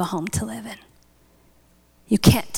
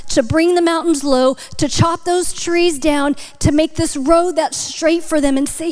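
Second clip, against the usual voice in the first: high, driving sermon delivery; impassioned preaching; 7 semitones higher than usual. Acted. Preacher urging believers to lead others to God, impassioned preaching. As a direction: exhort the congregation with rising urgency.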